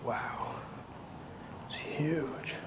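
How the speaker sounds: hushed awed whisper. Real, not acted. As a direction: breathe it softly inside a church. Entering a quiet church.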